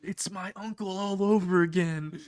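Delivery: high-pitched mock cry for help; hammed-up squealing plea; mock scream. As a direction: yelp in a comic panic, without full volume. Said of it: "Mineta's just screaming".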